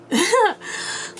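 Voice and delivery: high-pitched burst of laughter. Real, not acted. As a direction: let a squeaky laugh out. Laughs after hype.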